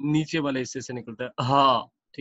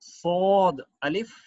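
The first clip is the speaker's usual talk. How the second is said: slow reading; 3 semitones higher, 5 dB louder, much slower than usual. Shown as a demonstration. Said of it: "next पढ़ते हैं सौद अलिफ".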